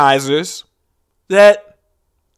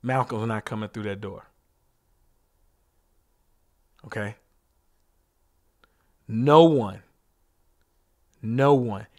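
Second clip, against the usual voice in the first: much softer and lower, grieving; hushed and somber; 9 semitones lower, 9 dB quieter than usual. Acted. Comic naming the dead to the crowd, hushed and grieving. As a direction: drop almost to a murmur, like reading names at a memorial. Somber list of victims' names.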